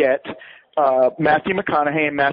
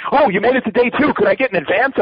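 higher, wheedling impression of a broke friend; mock begging friend; 7 semitones higher than usual. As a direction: whine for money in a pitched-up voice. Broke friend begs for advance.

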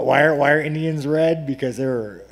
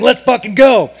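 higher, louder hype shout; patriot rally shout; 8 semitones higher, 7 dB louder than usual. Retold or imitated. Mocking patriots, a rally shout. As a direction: yell it like a flag-waving fan.

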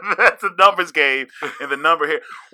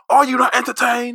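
booming defiant gladiator; 10 semitones higher than usual. Pretend. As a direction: roar it to the crowd. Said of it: "like a gladiator in the arena".

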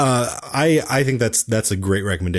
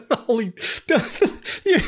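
high squealing laugh, breathless; laughing so hard the voice squeaks; high laughter. Real, not acted. Friends tease him.

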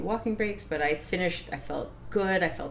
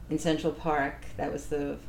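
ordinary talk

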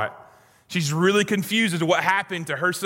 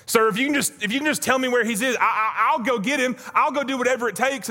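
pleading Mary; 7 semitones higher than usual. Acted.

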